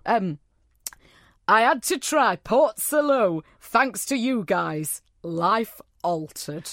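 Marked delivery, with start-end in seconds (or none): 1.5-6.7 dramatic slow reading. Pretend. Reading listener message dramatically.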